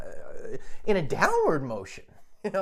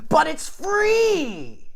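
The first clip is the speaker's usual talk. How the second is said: high, very slow, loud bark; barked like a dog; 6 dB louder, much slower than usual. Retold or imitated. Cross-eyed lady barks back at him like a dog. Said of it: "and like a dog, she says".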